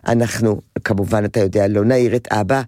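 ordinary talk

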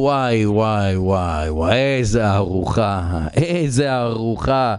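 slow, low, drawn-out mock savouring; relishing cannibals. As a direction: stretch it out low, drooling over a meal. Cannibals relish the fat king. (קניבלים מתענגים, לאט ובטון נמוך)

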